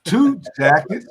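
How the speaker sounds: TWO drawn out, high and loud; said like you mean it. Shown as a demonstration. Co-host shows Daryl how to announce his nickname, emphatic. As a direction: punch out "Two Jackets", slow and loud. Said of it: "You got to say it like we, you mean it".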